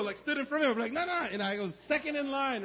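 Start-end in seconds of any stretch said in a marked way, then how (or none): 0.9-1.3 mock protest. Retold cutting in line. 1.7-2.6 mock insistence. Retold claiming his place.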